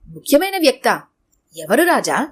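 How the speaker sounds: plain reading voice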